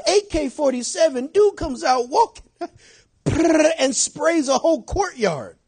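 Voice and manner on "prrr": rolled mock rattle of an automatic rifle; spray the sound like bullets; gunfire noise. Preacher imitating the gunman spraying the courtyard, rattling gunfire noise. Acting out a drive-by shooting.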